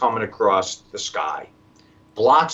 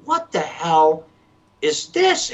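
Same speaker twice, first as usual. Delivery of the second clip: a mock startled cry, jumping way up high; shocked neighbors; 6 semitones higher, 4 dB louder, slower than usual. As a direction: play the stunned neighbor, voice leaping up. Neighbors quoted, pitch jumps.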